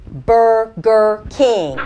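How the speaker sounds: very slow, syllable by syllable; drawn-out, over-careful enunciation; slow and distinct. Acted. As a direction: spell it out slowly for a newcomer. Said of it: "speak slowly and distinctly".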